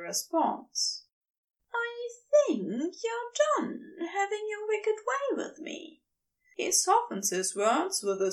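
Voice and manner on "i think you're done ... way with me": much higher, teasing, fading to a breath; higher Aziraphale, tender tease. Acted. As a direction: tease him high, then let it fade.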